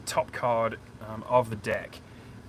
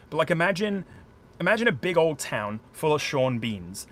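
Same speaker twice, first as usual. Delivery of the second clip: ordinary delivery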